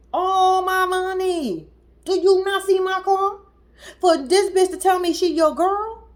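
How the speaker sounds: high, wailing indignation; mock outrage. Wronged woman confronting the man she spent on, outraged. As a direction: protest it high-pitched, as the wronged girlfriend.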